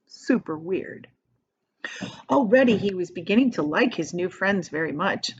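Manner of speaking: ordinary delivery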